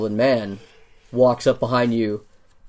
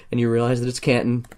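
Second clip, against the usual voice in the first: ordinary delivery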